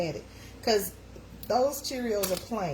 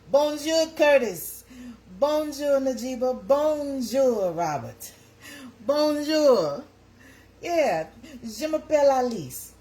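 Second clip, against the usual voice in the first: French greeting; 7 semitones higher, 6 dB louder, slower than usual. Shown as a demonstration. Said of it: "I took French two years in high school".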